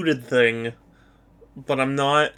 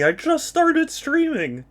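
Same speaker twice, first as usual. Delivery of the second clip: mock whine; 9 semitones higher than usual. Mock complaint to a teasing viewer.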